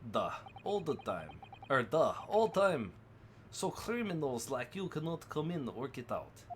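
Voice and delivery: lightly put-on Russian accent, broken English; a hint of a gruff Russian sailor guarding the cabins. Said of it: "same Russian voice".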